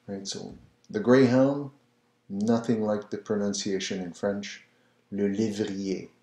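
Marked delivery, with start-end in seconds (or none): none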